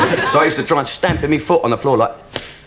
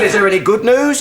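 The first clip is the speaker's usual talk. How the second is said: mock desperate owner; 9 semitones higher, 3 dB louder than usual.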